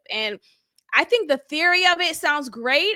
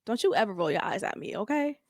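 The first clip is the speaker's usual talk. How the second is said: put-on stern boyfriend; 4 semitones lower, 7 dB quieter, faster than usual. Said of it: "He said".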